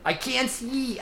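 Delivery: high, nasal-free nebbish whine; whiny panicked little scorpion; Woody Allen. Acted. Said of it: "if I were doing Woody Allen".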